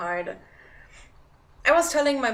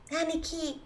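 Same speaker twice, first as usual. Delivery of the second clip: a bit higher, soft anime call; lightly put-on anime voice; 7 semitones higher, 5 dB quieter than usual. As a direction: say it like a little sibling, lightly. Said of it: "I'm more of an Aniki person".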